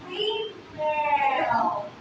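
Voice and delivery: "female" stretched into two very slow syllables; syllable counting. Shown as a demonstration. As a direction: draw out "female", one beat per syllable. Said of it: "two syllables".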